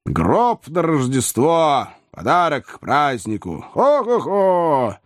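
high, slow gloating with a ho-ho-ho; gleeful acted laughter. A narrator's reading. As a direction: relish the grim joke, then hoot it out. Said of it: "засмеялся Гэбриэл Граб".

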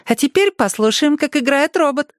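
put-on high childlike teasing voice; a small girl coaxing him to play; playful little girl. A narrator's reading. Girl teasing Erik to play, high childlike voice.

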